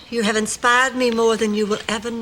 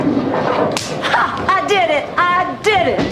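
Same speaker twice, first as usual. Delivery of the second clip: triumphant cheer; 6 semitones higher, slower than usual. Real, not acted. Triumphant cheer at bowling alley.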